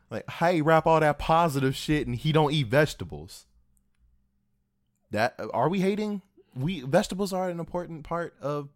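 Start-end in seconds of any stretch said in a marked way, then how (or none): 0.3-3.4 raised, higher mock yell. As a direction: call it out like a heckler.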